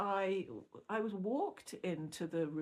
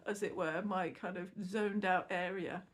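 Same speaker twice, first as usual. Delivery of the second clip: ordinary talk, nothing marked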